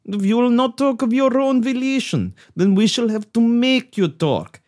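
hammed-up Russian villain gloating; spring the trap in a cartoon Russian accent; mock Russian accent.

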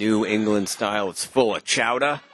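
hammed-up New England accent; fake New England accent. Co-host riffing on the beer's name, fake New England accent. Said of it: "my fake New England accent".

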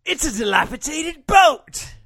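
high, loud mock-wrestler yell; imitated scream. Co-host imitates the wrestler's favorite line, hammed-up scream. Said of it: "he screams out".

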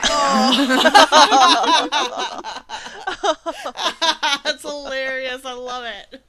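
bursting into laughter; laughing. Real, not acted. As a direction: laugh through the words. Laughing at the ship name.

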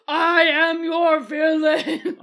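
slow, loud, laughing villain boast; hammed-up wrestling heel. Odin to the crowd as a hammed-up wrestling villain. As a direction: ham up a wrestling heel promo. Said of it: "he's just like".

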